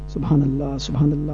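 low, soft repeated devotion; feigned quiet praying. Retold or imitated. Scared worshipper hiding after amen, low murmured praise. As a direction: keep it down, as if absorbed in prayer.

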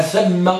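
ثم said as "thamma", with an a vowel; fatha on the tha. Shown as a demonstration. Teacher turning to the pointing word, ثم with a as "thamma". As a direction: say ثم as "THAM-ma". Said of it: "بفتح المثلثة".

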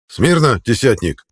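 loud parade command, high pitch; barked order. A narrator's reading. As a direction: bark the order at him. Centurion commanding attention.

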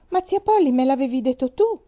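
acuta e più forte, sbalordita; stupita. Acted. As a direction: una bambina che non capisce la reazione. Pollyanna stupita dalla reazione. (stupore acuto e incredulo)

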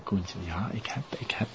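hushed, secretive confession; whispered. Acted. Tom admitting the killing to Koen, whispered.

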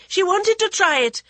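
high, loud pleading wail; wailing boy. Acted. Village boy wails his excuse while being scolded. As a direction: plead desperately for mercy. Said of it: "the boy wailed".

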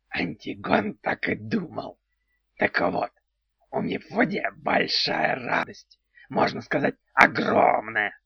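odd creaky little house spirit; goblin voice. Acted. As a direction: play a quirky house goblin, slowly.

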